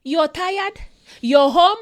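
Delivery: usual speaking voice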